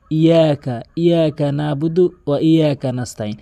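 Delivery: "ইয়াকা" quick, with a single undoubled y; common mispronunciation. Shown as a demonstration. Teacher mimicking what most people say, undoubled and plain. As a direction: rush through "ইয়াকা" without the doubling. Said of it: "এটি সম্পূর্ণ ভুল".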